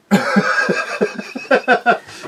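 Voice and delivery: airy high laugh; breathy laugh. Real, not acted. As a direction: let out a breathy giggle. Laughing at his son's pick.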